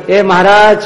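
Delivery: loud high call to stop; mock shout across the room. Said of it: "બ્રહ્મચારીએ ત્યાંથી બૂમ પાડી".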